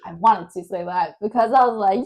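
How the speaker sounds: ordinary delivery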